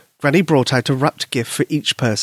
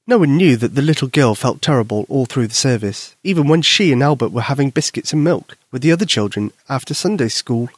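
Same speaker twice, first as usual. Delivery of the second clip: plain reading voice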